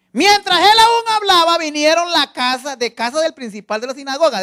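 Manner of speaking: preached scripture reading. A narrator's reading. Preacher reading to the church, loud proclaiming.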